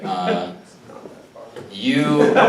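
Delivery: normal talking voice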